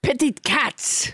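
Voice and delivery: mock-French accent, insisting; French Trump. French Trump repeats the demand to a puzzled host, French accent. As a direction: repeat it firmly in hammy French. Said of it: "with a French accent".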